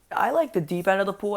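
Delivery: normal talking voice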